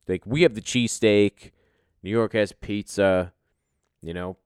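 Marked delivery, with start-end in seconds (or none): none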